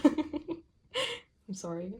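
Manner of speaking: drawn-out laughing sorry; laughing apology. Real, not acted. Laughing apology after saying moist.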